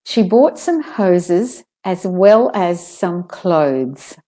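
slow sentence, buzzing z in "hoses", "as" and "clothes"; slow, careful reading, z ending on "clothes"; clear Z's. Shown as a demonstration. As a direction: model "hoses as well as" with voiced z. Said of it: "saying the Z's properly".

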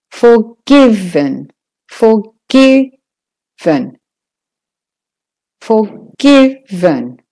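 slow "forgiven" with a strong GI; stress on GI. Shown as a demonstration. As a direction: punch the middle syllable of "forgiven". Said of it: "The gi is the stressed part".

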